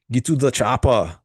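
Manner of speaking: put-on quick Austrian-accented movie line; Arnold impression. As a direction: bark it like the action hero. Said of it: "Haha, that's actually pretty good." My, my, uh, Arnold impression".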